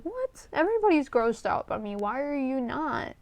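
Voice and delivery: high-pitched retold disbelief, starting on a squeaked question; incredulous. Baffled worker questioning the manager who high-fives her, incredulous. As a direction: act out being baffled, pitched well up.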